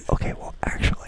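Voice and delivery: breathy mock whisper. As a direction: whisper it like a secret. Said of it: "He thinks I'm gonna whisper the detail".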